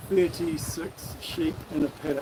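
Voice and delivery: slow, careful enunciation; slow and measured, letting each word land; said slowly. Shown as a demonstration. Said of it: "Say it slowly".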